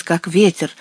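usual speaking voice

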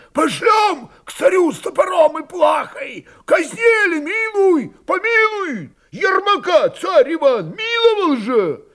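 high, impassioned, loud oratory; shouting to crowd. A narrator's reading. Shaggy Cossack urges suing the Tsar for mercy, shouting. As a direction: holler each argument from atop a barrel. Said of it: "когда крикнули о царе".

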